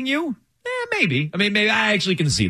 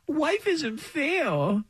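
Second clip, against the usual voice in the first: high, very slow crybaby whine; whiny baby voice; 10 semitones higher, 3 dB quieter, much slower than usual. Retold or imitated. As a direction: drag it out like a pouting toddler. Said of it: "that's how they sound".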